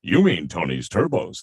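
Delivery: a hint of the tiger voice; lightly voiced cartoon mascot; Tony the Tiger. Showing studio staff he can do the tiger line. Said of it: "And I go".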